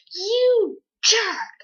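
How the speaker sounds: high, loud yell of outrage at a traitor; angry yell. Retold or imitated. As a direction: shout it at the betrayer. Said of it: "I was pissed".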